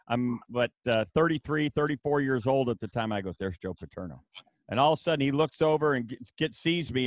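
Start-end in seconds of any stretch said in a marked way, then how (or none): 3.4-4.2 much softer, lower hushed aside. Retold or imitated. Starstruck coach hushing to his wife at the pool. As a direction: lean in and hush it to your wife. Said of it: "I'm whispering to my wife".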